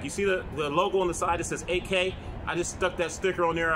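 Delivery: ordinary talk, nothing marked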